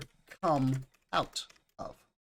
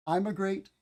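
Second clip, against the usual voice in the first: "I'm a great" said as three words, the I pulled apart like "I'm"; "I'm a great" spoken as separate words to mark the I spelling; split into I'm a great; 5 semitones higher than usual. Shown as a demonstration. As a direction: break immigrate into I'm a great. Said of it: "we'll often say it".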